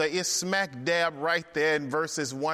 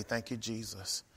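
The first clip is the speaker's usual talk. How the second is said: soft prayer; 8 semitones lower, 12 dB quieter than usual. Retold or imitated. Morning prayer quoted.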